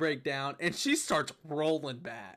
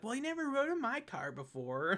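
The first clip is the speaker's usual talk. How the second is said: higher, softer put-on voice; lightly mocked high protest; imitated female friend; 6 semitones higher, 5 dB quieter than usual. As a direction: imitate her objecting in a high voice.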